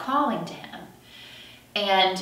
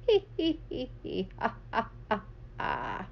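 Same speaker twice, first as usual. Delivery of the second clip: imitated laugh; 5 semitones higher, 7 dB quieter than usual. Dad laughing away.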